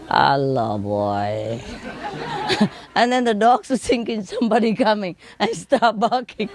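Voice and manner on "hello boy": low, drawn-out mimicked greeting; dream talk. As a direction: murmur it slowly like a parrot asleep. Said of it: "they talk in a dream".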